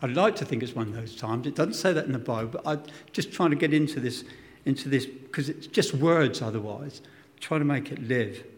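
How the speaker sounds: usual speaking voice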